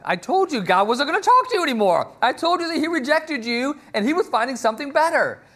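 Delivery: high-pitched, insistent scolding; acted exasperated rebuke. Samuel telling Saul God rejected him, acted exasperation. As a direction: play a fed-up prophet laying down the verdict.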